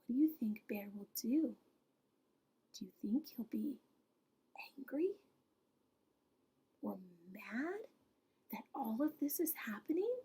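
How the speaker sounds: soft whisper, quick and breathy; hushed questions. Real, not acted. Asking the children in a whisper what Bear will do.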